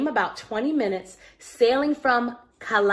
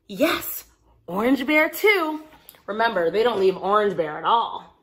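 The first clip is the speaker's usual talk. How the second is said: ordinary delivery, nothing marked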